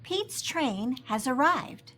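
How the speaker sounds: ordinary delivery, nothing marked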